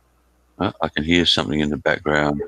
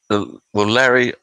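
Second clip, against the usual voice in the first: ordinary talk, nothing marked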